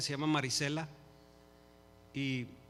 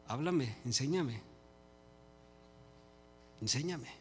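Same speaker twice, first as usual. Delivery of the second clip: humble plea; 2 semitones lower, 6 dB quieter than usual. Acted. Believer asking the Lord to teach him, soft humble plea. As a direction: ask softly and humbly, taking your time.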